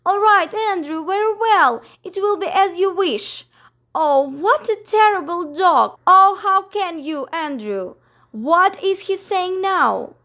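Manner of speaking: high, loud, hurried giving in; flustered Miss Lark. Acted. Miss Lark gives in to Andrew, high and flustered. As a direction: gabble in a fluster.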